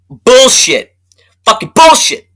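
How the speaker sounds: furious dismissal; angry outburst. Real, not acted. Ranting to viewers about the repeated line, angry outburst.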